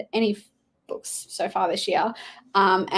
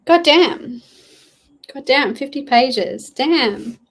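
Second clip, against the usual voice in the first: impressed exclamation; 4 semitones higher, 6 dB louder, slower than usual. Real, not acted. Impressed by viewer's pages.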